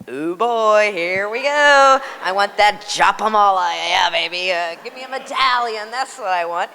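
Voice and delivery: giddy greedy inner voice; greedy excitement. Retold or imitated. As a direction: rub your hands and list the loot. Greedy excited inner wish list.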